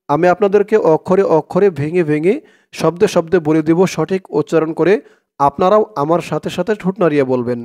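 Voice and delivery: ordinary talk, nothing marked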